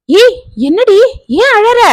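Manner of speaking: startled that she's crying; alarmed cry. A narrator's reading. Shanthi alarmed as her cousin bursts into tears.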